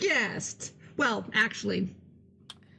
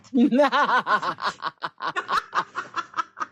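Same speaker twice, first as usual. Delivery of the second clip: long loud villain laugh; evil cackle; 8 dB louder than usual. Pretend. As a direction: cackle wickedly. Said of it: "she keeps laughing like that".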